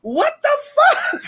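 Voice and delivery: high, laughing what the fuck; incredulous laughing cry; laughing disbelief. Real, not acted. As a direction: squeal it out in disbelief.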